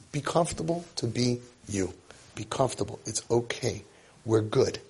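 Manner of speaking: soft, low "be comfortable"; calm the child gently; soothing parent. Pretend. Parent telling the child it's okay to be himself, soft and soothing.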